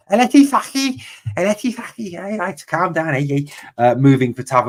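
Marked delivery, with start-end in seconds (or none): 0.1-2.1 high, exaggerated Scouse accent on El Ettifaqi. Football streamer jokes a club name in a Scouse accent. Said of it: "in a Scouse accent". 2.1-3.5 a lightly voiced jokey Scouse calm down; Scouse impression. Streamer carries on the Scouse joke for viewers, mock Scouse accent. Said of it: "in a Scouse accent".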